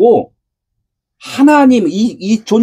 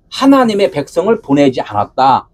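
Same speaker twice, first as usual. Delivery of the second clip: ordinary delivery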